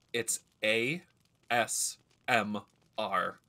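"A S M R" spelled out letter by letter, each one separated. Retold or imitated. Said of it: "they're like".

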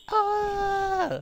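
high sharp ah; high shocked cry; startled yelp. Real, not acted. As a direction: yelp when hit. Ambushed in hot zone.

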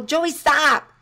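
sharp loud shout of a name; yelled warning. Shown as a demonstration. Parent yelling at child heading into the street, sharp shout. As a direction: yell to stop a child running into the street. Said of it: "because you yell".

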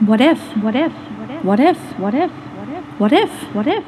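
repeated anxious what-ifs, loud then faint; many little worries nagging at once; rising, overlapping what-ifs in anxious voices; chorus of worry voices. Acted.

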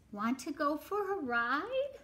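a hint of a friendly storybook horse; lightly voiced horse. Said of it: "said the horse".

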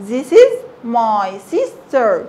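drawn out for learners; slow clear model sentence. Shown as a demonstration. Teacher models the sentence slowly for pupils.

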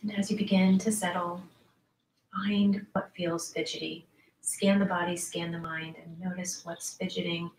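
soft, unhurried yoga nidra voice with long pauses; guided meditation. Acted. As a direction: ease listeners gently into letting the body go. Leads yoga nidra relaxation.

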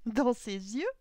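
high, loud, startled echo; incredulous question. A narrator's reading. Old clockmaker repeats the suitor's words, loud incredulous question. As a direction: echo it back with disbelief. Old man echoes in disbelief.

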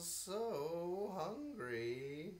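slow, drawn-out hungry moan; hungry whine. Acted. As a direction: plead weakly with hunger. Hungry bee moans.

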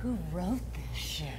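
hammed-up hushed disbelief; soap opera hush. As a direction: murmur it low like a stunned soap actress. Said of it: "I wanna hear the inflection on this one".

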